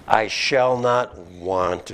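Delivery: slow, weighed-out quotation; slow solemn verse reading; slow scripture reading. Shown as a demonstration. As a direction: let each word of the verse land. Quoting scripture slowly.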